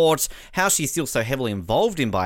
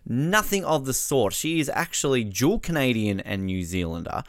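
normal talking voice

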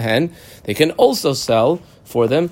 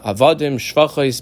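ordinary talk, nothing marked

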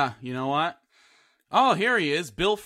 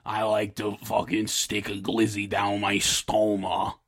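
Big Inge voice; 7 semitones lower than usual. Pretend. Big Inge introduces himself to everybody, low and croaky. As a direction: rumble it out low as a throat-hole character. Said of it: "Big Inge".

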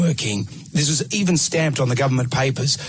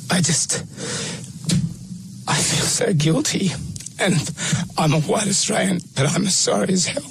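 choked-up apology, near tears; real tears through the words; crying; 6 semitones higher than usual. Real, not acted. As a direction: voice breaking, pitched up with guilt. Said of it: "I can see you crying now".

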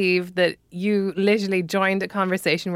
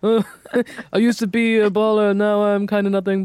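mock boyfriend voice. Listener guessing at his password, lightly mocking boyfriend voice. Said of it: "Probably just like".

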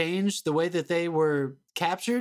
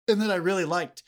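usual speaking voice

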